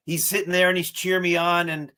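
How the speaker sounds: ordinary delivery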